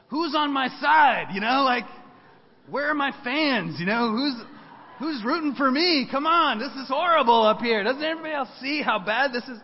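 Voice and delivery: high, loud self-pitying complaint; mock prideful whine. Prideful young man complaining nobody backs him, in a high loud mock whine. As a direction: ham up a wounded ego. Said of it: "all that super prideful stuff".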